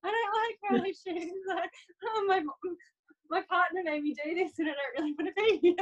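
high whiny voice of a grumbling client; reluctant client. Retold or imitated. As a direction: whine high like someone dragged to a family shoot. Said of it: "when they're sitting there going".

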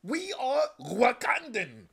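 high, slow, regal proclamation; mock-stately Wakandan accent; stretched, lofty royal declaration; formal Wakandan voice. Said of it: "everyone in Wakanda was like".

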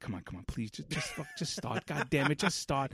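soft, pleading under the breath; begging the engine quietly; desperate muttering. Retold or imitated. Begging the car to start.

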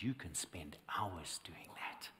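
slow hushed whisper for the voice of God. Retold or imitated. Recalling God's word to him, in a slow whisper. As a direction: draw it out softly, barely voiced. God's words retold to the preacher.

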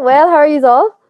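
ordinary talk, nothing marked

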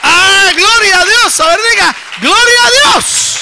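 high, drawn-out shout of praise. Real, not acted. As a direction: shout it slowly to rouse the whole congregation. Leading the congregation in praise. (grito de alabanza largo y a toda voz)

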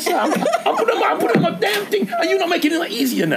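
high-pitched flustered comeback, half laughing; comic exasperation; mock exasperated protest. Retold flustered protest to court man.

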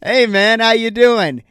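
high, loud friendly hello; cheery greeting. Retold or imitated. Greeting the trainer at the gym, loud and cheery. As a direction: call out to a buddy across the gym.

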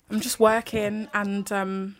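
slow, soft, a little shaky; holding back emotion, voice wavering; steady voice with shaky moments. Real, not acted. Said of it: "Sorry, I get emotional".

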